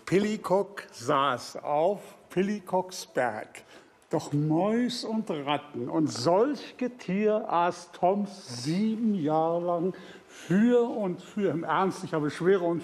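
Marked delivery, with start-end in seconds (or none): 0.0-11.5 slow solemn verse recital; recited verse. Acted. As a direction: intone the old rhyme slowly. Direktor rezitiert Verse. (langsam feierlich rezitiert)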